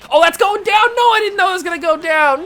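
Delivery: loud, high-pitched yelling in dismay; panicked shout. Real, not acted. Gamer seeing a double play coming, panicked shouting. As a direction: cry out as the play falls apart.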